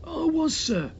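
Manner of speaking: high sheepish servant voice; shamefaced servant. A narrator's reading. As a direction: protest like an embarrassed butler.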